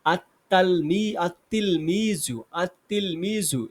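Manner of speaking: "আত-তিলমিজু" said slowly, the lam silent and the ta doubled: at-tilmiju; slow teacher's model of "আত-তিলমিজু" with no l sound; careful Arabic pronunciation. Shown as a demonstration. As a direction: spell out "আত-তিলমিজু" syllable by syllable with a doubled t. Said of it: "তাহলে আমাদের উচ্চারণ আসবে".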